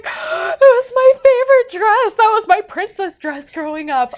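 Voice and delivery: gasp, then loud, high wail; high-pitched, dramatic protest; retold dismay. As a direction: re-enact being devastated at Mom. Retells dismay at favorite dress donated.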